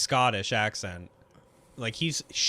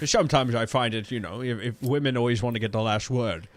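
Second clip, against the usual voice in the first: Scottish star with slushy S's; mock Scottish interview voice, S turned to SH; Sean Connery; 3 semitones lower than usual. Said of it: "do a Sean Connery voice for us".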